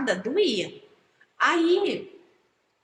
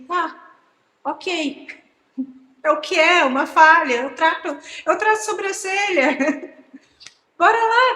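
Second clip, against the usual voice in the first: louder and higher, shrugging it off with a laugh; upbeat self-pep talk; 6 semitones higher, 8 dB louder than usual. Retold or imitated. Telling herself about the hair loss, loud brave shrug. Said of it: "eu falei assim".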